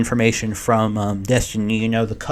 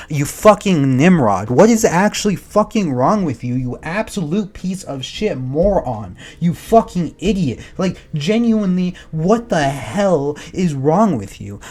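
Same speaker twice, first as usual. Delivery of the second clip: angry insults; 3 semitones higher, 5 dB louder than usual. Real, not acted. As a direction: spit out the insults one by one, furious. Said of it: "it makes me frustrated".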